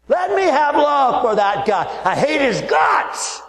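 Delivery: high, slow hammed-up prayer bursting into a shout; mock angry prayer. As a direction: pray sweetly then spit out the hate. Mock prayer turning to anger.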